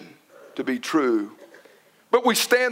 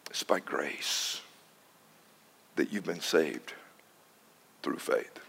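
whispered verse; 12 dB quieter than usual. Acted. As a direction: breathe the verse softly.

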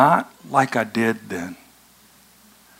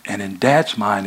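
ordinary talk

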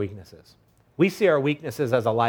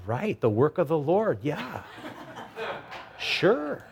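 sarcastic agreement; 3 semitones higher, 4 dB quieter, slower than usual. Acted. Hosea answers God's marriage order, sarcastically agreeing. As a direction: humour an absurd request, climbing to a squeaked sure. Mock reply to marry a prostitute.

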